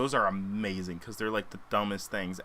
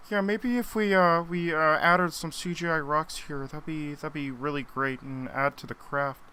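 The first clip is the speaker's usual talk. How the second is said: George Lucas impression; 5 semitones higher than usual. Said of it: "George Lucas starts showing up".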